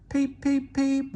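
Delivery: high whistle peeps; engine whistle. A narrator's reading. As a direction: toot like a little engine. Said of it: "whistled Thomas".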